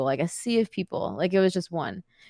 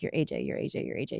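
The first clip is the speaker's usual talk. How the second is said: soft, voiceless self-encouragement; whispered pep talk; 6 semitones lower, 8 dB quieter, faster than usual. Retold or imitated. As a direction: whisper it to yourself to psych up. Psyching herself up backstage.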